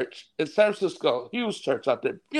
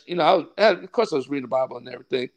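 ordinary delivery

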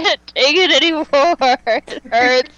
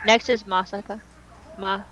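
ordinary talk

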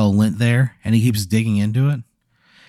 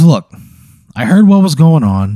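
a touch higher and louder; a friendly creature voice, lightly played; lightly voiced snooze monster; 6 semitones higher, 7 dB louder than usual. Snooze monster consoling the player, lightly voiced.

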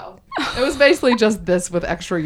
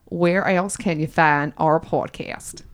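put-on Southern accent. Host quizzes co-host on podcast sites in a Southern accent. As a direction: set the quiz in a Southern accent. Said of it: "do it in this accent".

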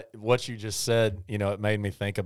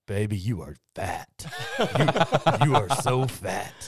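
hammed-up hippo lover blurting it out fondly; a hint of a hippo boyfriend teasing his girlfriend; deep-voiced hippo; 4 dB louder than usual. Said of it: "a pretty good impression of that".

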